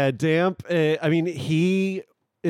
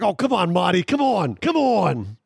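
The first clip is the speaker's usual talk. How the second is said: mock-frustrated pleading, pitched up; exasperated urging; 5 semitones higher than usual. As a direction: urge Marty on in acted exasperation. Acting Damp, angry at the snow.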